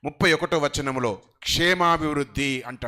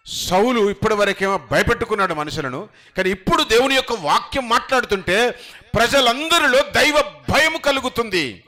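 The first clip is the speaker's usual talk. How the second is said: rising sermon cry; full-force preaching; 6 semitones higher, 6 dB louder than usual. Acted. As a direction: proclaim it to the whole hall. Preaching build.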